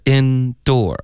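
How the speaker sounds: ENDURE as two separate, equally stressed syllables, like "in-door"; even double stress. Shown as a demonstration. Teacher explaining indoor to a student, even double stress. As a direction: stress both halves of endure fully, held apart. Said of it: "两个音节都算是一个重音".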